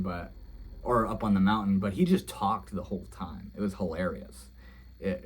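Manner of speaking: ordinary delivery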